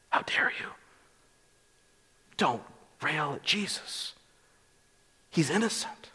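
faint, breathy-thin rebuke from a dying man; a dying man's feeble protest, half whispered; weak voice. Retold or imitated. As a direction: barely get the words out, weak and soft. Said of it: "It was probably very weak".

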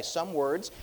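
ordinary talk

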